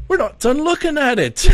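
high, loud mock British protest; indignant Brits. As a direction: squawk it as an affronted Brit, played for laughs. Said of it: "the Brits are just like".

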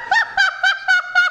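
very high, laughing squeal; a real laugh breaking out high; high burst of laughter. Real, not acted. Cohost cracks up at his own joke with a high squeal.

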